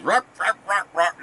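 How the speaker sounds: very high, quick "rock, rock" yaps; rapid high dog chatter; high-pitched fast yapping. Retold or imitated. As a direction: squeak it out fast like an angry little dog. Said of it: "high pitched, this real fast".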